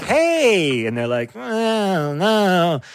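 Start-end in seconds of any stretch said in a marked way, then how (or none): 0.0-0.8 high, drawn-out hey; acted high-pitched hello; bright greeting. Acted greeting to a toddler-like celebrity. 1.3-2.8 high, drawn-out refusal; mock toddler whine; fussy toddler. Acted fussy celebrity as toddler.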